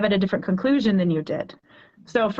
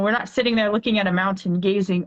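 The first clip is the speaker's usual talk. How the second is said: usual speaking voice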